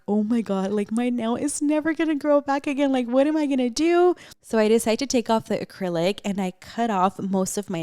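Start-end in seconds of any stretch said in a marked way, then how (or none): 0.1-4.1 high, rising, louder panicked wail; retold freak-out. Telling viewers about her dead nail, dramatised freak-out. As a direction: spiral upward in dramatised panic. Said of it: "here's where I start freaking out".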